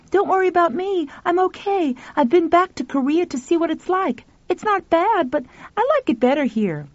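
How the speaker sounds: put-on boy voice. Pal's dream voice.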